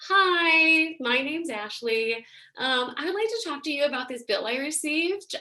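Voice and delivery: put-on nice phone voice, lifted at the greeting; sweetly polite caller to customer service. Teacher imitating a caller to the insurance company, customer service voice. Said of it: "a customer service voice".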